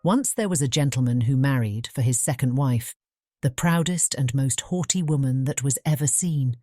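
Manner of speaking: ordinary delivery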